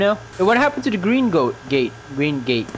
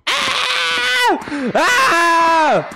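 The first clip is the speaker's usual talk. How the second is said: very high, long screams; mock screaming. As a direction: imitate a screaming lady, held high. Imitates the screaming lady.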